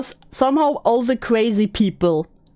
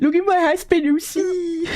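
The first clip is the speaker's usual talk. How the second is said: mock fangirl; 6 semitones higher than usual. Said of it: "echoing the quote".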